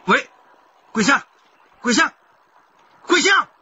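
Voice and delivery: high, loud, building cries of her name; frantic calling. Acted. Husband shouts into the dead phone after his wife.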